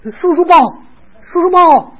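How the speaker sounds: put-on high, loud sing-out to a little child; high-pitched baby-talk coaxing; coaxing a toddler. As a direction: coax a small child in a high, eager voice.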